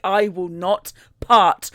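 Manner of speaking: slow, emphatic mock refusal; hammed-up slow defiance; stubborn refusal. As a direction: spell it out word by word, immovable.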